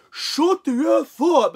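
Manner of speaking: high, loud, stretched-out shout; slow, bellowed mock yell; imitated yell. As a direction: re-enact a stranger's outburst. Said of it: "and yelled this".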